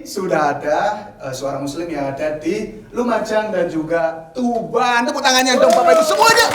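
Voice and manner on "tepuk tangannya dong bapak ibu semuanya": high, loud cheerful call to the audience; rally the crowd for applause; excited host call. Real, not acted.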